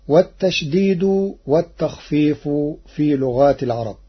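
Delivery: ordinary talk, nothing marked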